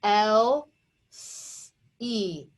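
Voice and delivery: "L E" in slow, separate chunks with long gaps; drawn-out phonics chunks "L E"; sounded out. Shown as a demonstration. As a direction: break healthy apart, leaving space before "E". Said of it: "So this says eh".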